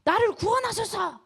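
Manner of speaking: high-pitched mock shout to the Lord; acted cry for help. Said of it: "라고 소리를 질렀어요".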